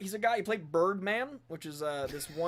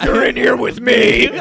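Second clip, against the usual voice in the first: loud, high, slow shout; Rorschach; 5 semitones higher, 14 dB louder, slower than usual. Retold or imitated. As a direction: bellow it like a caged vigilante. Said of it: "Rorschach line".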